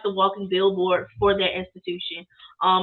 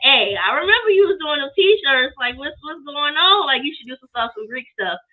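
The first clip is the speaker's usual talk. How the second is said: louder, higher, eager sorority sister; big sister voice; 6 semitones higher, 5 dB louder than usual. Retold or imitated. Big sister urging her to make Greek shirts, eager and loud.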